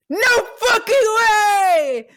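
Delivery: high, loud, slow yell; ecstatic shout. Retold or imitated. Actor hearing his own voice copied, shouting high and loud. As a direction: bellow it in delight.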